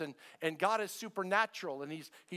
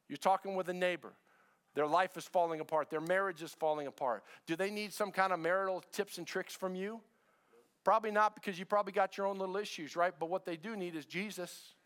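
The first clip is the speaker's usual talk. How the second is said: full-force preaching; 5 semitones higher than usual. Acted. Pastor urging the church to be who they are, preaching. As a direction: preach it out, building.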